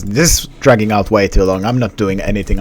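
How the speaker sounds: normal talking voice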